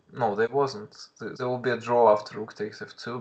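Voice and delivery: usual speaking voice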